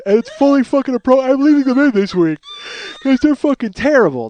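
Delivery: loud yawning speech. Real, not acted. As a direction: stretch the words through a yawn. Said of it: "I'm gonna yawn again".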